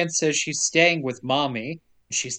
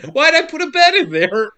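high, loud self-scolding whine; mock exasperated; 11 semitones higher, 7 dB louder than usual. Husband curses himself over the garage bed, high loud whine.

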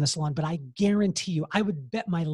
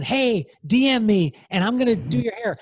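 mock social-media solicitation; 5 semitones higher, 4 dB louder than usual. Voicing kids soliciting clients on Instagram.

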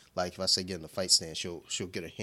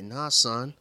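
put-on higher, louder kid voice; imitating six-year-old; 5 semitones higher, 6 dB louder than usual. Dad imitating his six-year-old daughter, higher and louder. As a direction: play the tough little girl. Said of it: "she was like".

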